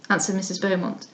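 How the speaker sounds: usual speaking voice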